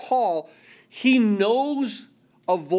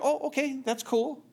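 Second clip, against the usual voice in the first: lightly voiced offhand shrug, pitched up and a bit softer; casual shrug; 8 semitones higher, 4 dB quieter than usual. Mock-casual reply to God saying I love you. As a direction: brush it off like it's no big deal.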